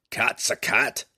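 insistent gruff dwarf; gruff gravelly dwarf voice. A narrator's reading. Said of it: "insisted the dwarf".

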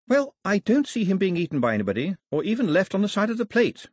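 put-on high-pitched, insistent young heroine; Daphne, carrying on. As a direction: play the girl pressing on with her point. Narrator voices Daphne, rattling on.

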